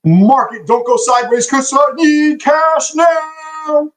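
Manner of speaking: high, slow whiny plea to the market; mock pleading. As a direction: beg the market in a high singsong.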